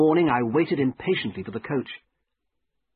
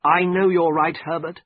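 understated misery, slightly raised pitch; miserable; 4 semitones higher, 5 dB louder than usual. A narrator's reading. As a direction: admit it with a touch of misery. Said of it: "I said miserably".

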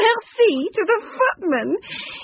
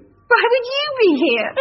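ordinary talk, nothing marked

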